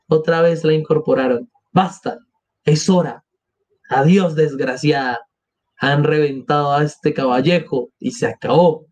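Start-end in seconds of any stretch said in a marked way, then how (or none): none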